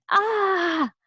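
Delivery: high, loud "ah" cry; scream of pain. A narrator's reading. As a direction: cry out loudly in mock pain. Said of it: "screamed with a pain".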